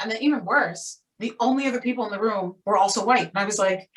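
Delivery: normal talking voice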